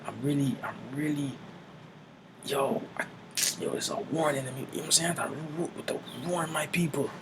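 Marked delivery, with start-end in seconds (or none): 0.1-4.6 hushed and high, awestruck. Retold or imitated. John in a trance, overwhelmed, hushed and high. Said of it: "he was like". 5.9-7.1 hushed and high, awestruck. Retold or imitated. John in a trance, overwhelmed, hushed and high. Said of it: "he was like".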